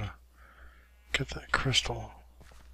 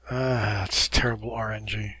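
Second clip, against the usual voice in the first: normal talking voice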